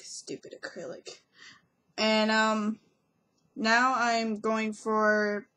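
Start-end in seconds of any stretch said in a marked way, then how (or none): 0.0-1.2 low-key grumble under the breath; muttered grumble. Retold or imitated. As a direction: mutter it softly, as if to yourself. Retold grumble at failed crush.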